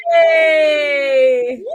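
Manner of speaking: high, slow, shouted announcement; excited shout. Real, not acted. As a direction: call out the winner's name. Announcing the winner.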